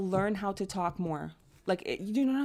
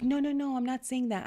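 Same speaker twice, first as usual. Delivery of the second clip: eager reassurance; 6 semitones higher than usual. Retold or imitated. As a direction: rush to reassure him. Reassuring partner, acted.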